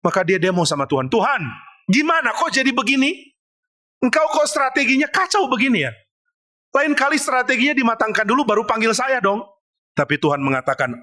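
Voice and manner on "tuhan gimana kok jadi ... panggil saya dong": high-pitched exasperated protest; complaining to God. Acted. Moses complaining to God about the botched plan, high exasperated protest. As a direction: whine up high like a fed-up worker. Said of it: "Musa komplain ke Tuhan".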